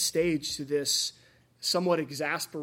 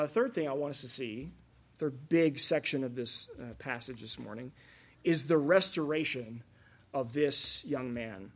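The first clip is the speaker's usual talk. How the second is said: normal talking voice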